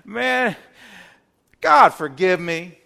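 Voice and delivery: laughing, loud, careless "Hey God, man"; mock a careless, joking apology; hammed-up flippant laughing prayer. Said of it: "we just don't come and say".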